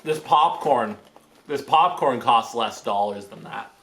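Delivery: normal talking voice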